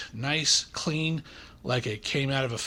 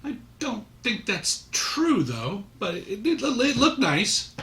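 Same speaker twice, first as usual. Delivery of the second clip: bemused; 10 semitones higher than usual. Real, not acted. Skeptical reaction to film.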